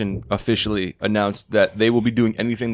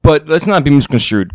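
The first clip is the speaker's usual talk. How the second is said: loud; 4 semitones higher, 8 dB louder than usual. Real, not acted. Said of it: "am I loud?".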